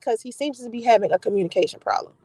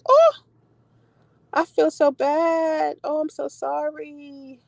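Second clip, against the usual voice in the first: sympathetic; 3 semitones higher, much slower than usual. Real, not acted. Sympathy to grieving viewer.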